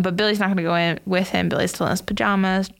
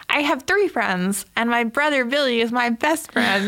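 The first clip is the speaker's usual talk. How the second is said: Georgie. Retold or imitated. Boy tells the clown about his friends, childlike. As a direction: lightly act a little boy proudly listing. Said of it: "he's very childlike".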